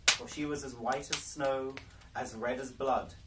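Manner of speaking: ordinary delivery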